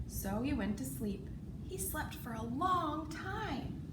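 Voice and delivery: ordinary delivery, nothing marked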